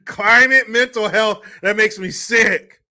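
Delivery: shouted disgust at the phrase; loud disgust. Real, not acted. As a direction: bark "Climate mental health" in revulsion. Disgusted outburst.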